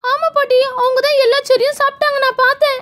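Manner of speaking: put-on high-pitched child tattletale; tattling little girl. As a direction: tell on them in a high girlish voice. Girl tells on the other children. (கோள் சொல்லும் சின்னப் பொண்ணு)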